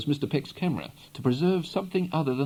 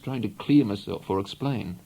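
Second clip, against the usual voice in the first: ordinary delivery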